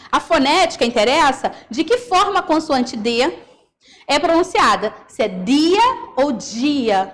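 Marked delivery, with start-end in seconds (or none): 5.1-7.1 first "dia" with a plain D, then "dia" with the Rio dj sound; slow "dia" then "dia" as in Rio; dia said two ways. Shown as a demonstration. Teacher contrasts "dia" plain and carioca "dia". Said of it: "como a gente fala aqui no Rio".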